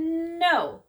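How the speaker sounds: loud, high drawn-out no; yelled refusal. A narrator's reading. Father and children refuse the man, yelled. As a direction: yell it out for a storybook. Characters yell a refusal in story.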